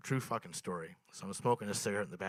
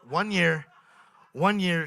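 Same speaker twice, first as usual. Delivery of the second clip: one year, one year punched loud and higher; emphatic repeat; 8 semitones higher, 10 dB louder, slower than usual. Acted. Comic building up to the turkey story, punchy repeat. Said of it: "repeats phrase for emphasis".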